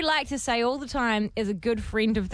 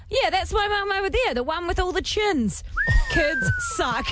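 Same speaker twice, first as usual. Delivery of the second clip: put-on Kiwi accent; 9 semitones higher than usual. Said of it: "his strong Kiwi accent say".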